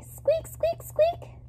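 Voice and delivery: very high, tiny squeaking hamster. A narrator's reading. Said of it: "I hear Humphrey say".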